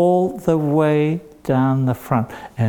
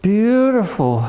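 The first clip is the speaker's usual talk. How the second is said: higher, admiring acted exclamation; delighted old lady; 5 semitones higher than usual. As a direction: coo over the gift, stretching the praise. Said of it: "and she said".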